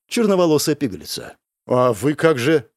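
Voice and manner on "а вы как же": usual speaking voice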